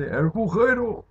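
playful callback. Acted. Gamer reminding her viewers of the hole, playful drawn-out call. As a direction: stretch it out like an old joke.

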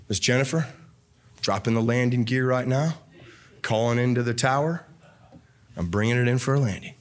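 low, lightly voiced pilot announcement; mock pilot landing. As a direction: play the pilot landing the sermon. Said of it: "I need to bring it down".

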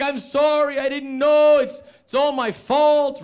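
high, loud wail of apology; imitated roommate's hammed-up apology; frantic apology. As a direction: cry out your guilt, dragging the end.

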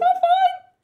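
high, squeaky panicked outburst; nervous shriek. Acted. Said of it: "a short, nervous outburst".

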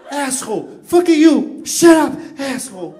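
mock English cursing. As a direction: yell it like an angry American. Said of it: "qualquer pessoa de língua inglesa, ô, querendo xingar".

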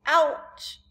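put-on small pained girl voice; little girl wince. A narrator's reading. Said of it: "Sophie said".

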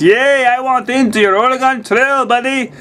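very high, hammed-up puppet frog; Kermit the Frog. Streamer modeling a viewer's request to join, high bad Kermit voice. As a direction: cheer like a badly imitated frog puppet. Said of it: "in a Kermit the Frog voice".

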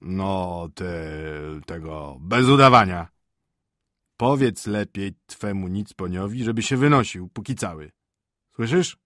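hesitant start, then loud ordering; gruffly threatening husband; stern, irritated master. Acted. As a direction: order the cook to get rid of her visitor.

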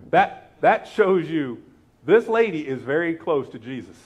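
ordinary talk

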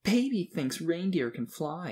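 high, slow sing-song taunt; mocking. A narrator's reading. Another kid taunting the believer, mockingly. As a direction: tease him like he's a baby. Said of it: "in a mocking tone".

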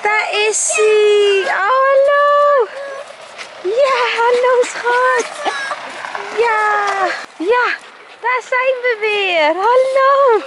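squealing hello to a beloved pet; excited dog greeting. Real, not acted. Greeting the dog, high pitched.

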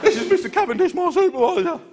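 high, proud little lad; squeaky teen clerk. Retold or imitated. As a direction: squeak the introduction like a kid. Teen clerk introduces supervisor.

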